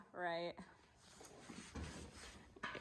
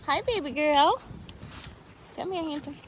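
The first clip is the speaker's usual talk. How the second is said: talking to a horse; 6 semitones higher than usual. Real, not acted. Greeting horses.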